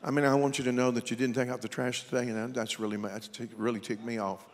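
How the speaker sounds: low, soft, hurried monotone; meek, undertoned complaint, comically calm; mock mild anger. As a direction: play being mad with no fight in it at all. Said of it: "I ain't never heard no woman that go".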